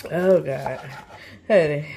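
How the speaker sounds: ordinary talk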